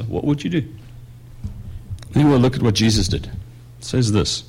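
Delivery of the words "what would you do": softer, murmured back to yourself; quiet reflective question. Shown as a demonstration. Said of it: "Just think".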